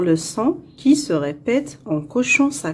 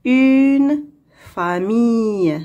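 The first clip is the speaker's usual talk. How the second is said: slow, stretched "une", then "famille"; slow model reading; slower than usual. Shown as a demonstration. Teacher reading for pupils to hear the sound, slowly. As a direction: read it out slowly, word by word. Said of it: "On va lire".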